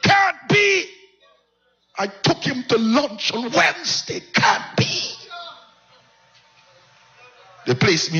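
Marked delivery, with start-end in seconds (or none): 0.0-5.2 high, anguished cry of disbelief; reliving the news that father is dead, anguished high cry; broke down. Retold or imitated. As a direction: break down, voice cracking high in denial. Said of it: "I broke down".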